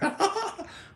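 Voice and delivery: short high-pitched laugh; quick high giggle. Real, not acted. Laughs at the odd remark.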